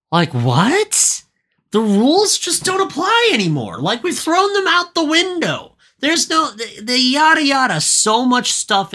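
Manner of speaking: high-pitched, incredulous outburst; exasperated rant. Real, not acted. Podcaster ranting about a movie ignoring its own rules, exasperated. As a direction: rail in disbelief at the broken rules.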